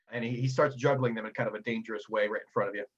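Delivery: usual speaking voice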